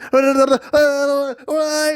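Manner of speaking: high, garbled hysterical babble; gibbering high-pitched mock scream; mock panicked screaming. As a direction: imitate wordless panicked wailing. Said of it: "He's screaming in my ear".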